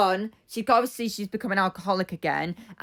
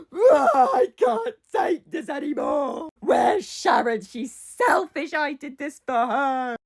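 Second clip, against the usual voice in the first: high, loud mock wailing with slow, drawn-out words; hammed-up crying; 5 semitones higher, 3 dB louder, slower than usual. Imitating Linda crying after being taken upstairs, wailing. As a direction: bawl it out in an exaggerated drunken sob. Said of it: "because she's crying".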